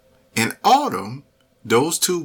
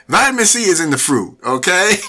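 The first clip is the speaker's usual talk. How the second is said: higher, louder and fast, laughing it off; excited; 7 semitones higher, 6 dB louder, faster than usual. Real, not acted. Host catching himself giving advice, excited and fast. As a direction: blurt out the fix with a grin. Joking correction of medical advice.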